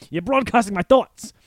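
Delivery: high, loud crazed accusation; paranoid rant. Retold or imitated. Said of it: "crazy people that keep coming in going".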